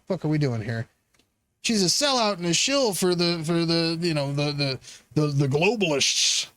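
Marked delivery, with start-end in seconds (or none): 1.6-6.5 lightly voiced pundit, pitched lower; mocking pundit. Streamer mocking critics, lower mocking pundit voice. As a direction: sneer out the talking point in a lower voice. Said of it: "They're talking about".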